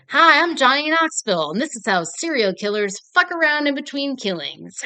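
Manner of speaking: a mock TV-host greeting that opens high and punchy; Johnny Knoxville voice. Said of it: "doing that voice for Johnny Knoxville".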